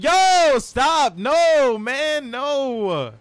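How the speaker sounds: high, drawn-out wail of protest; loud, high, pleading shout. Real, not acted. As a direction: yell in dismay at being teased. Protesting voice crack teasing.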